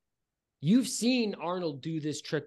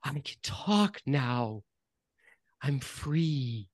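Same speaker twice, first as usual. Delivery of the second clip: slow, quavering nasal voice; a hint of a quavering nasal voice; much slower than usual. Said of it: "quavering nasal voice say".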